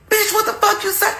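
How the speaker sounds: high, strained mock challenge; imitating a man ready to fight; confrontational husband. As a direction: get in their face with it. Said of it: "He jumped up in my face so quick".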